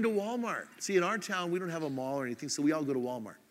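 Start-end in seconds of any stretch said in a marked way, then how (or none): none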